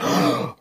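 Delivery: sharp re-enacted gasp; gasp for air. Man acts out seeing the time, shocked gasp. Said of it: "I just gasped for air".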